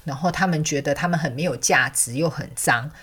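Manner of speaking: ordinary talk, nothing marked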